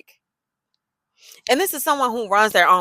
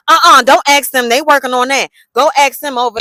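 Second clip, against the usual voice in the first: loud, higher mock trainer voice; bossy coworker waving her off; loud snippy coworker imitation; 6 semitones higher, 10 dB louder than usual. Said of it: "Here she go".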